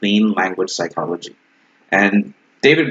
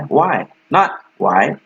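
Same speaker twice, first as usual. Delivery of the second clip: why down, then why up, slowly; why falling, then rising; 4 semitones higher, much slower than usual. Shown as a demonstration. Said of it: "The first good thing to use is why".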